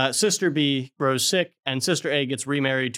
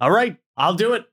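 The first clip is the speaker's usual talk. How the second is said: higher, louder, hammed-up yes; mock eager agreement; 7 semitones higher, 5 dB louder than usual. Brother accepting Satan's deal, hammed-up eagerness. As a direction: give in to the devil with gusto. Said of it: "he goes".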